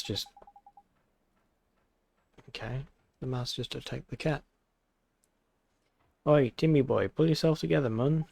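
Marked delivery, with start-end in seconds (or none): 6.3-8.2 a chatty Welsh sidekick, loud and cheery; Welsh character voice. Acted. Drippy calling to Oliver, hearty Welsh voice. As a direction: call out in a Welsh lilt like an old friend.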